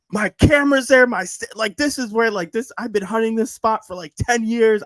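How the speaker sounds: high, loud retold outburst of dream rage; fuming. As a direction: rant like your hunting spot was just bulldozed. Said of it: "I was fuming in my dream".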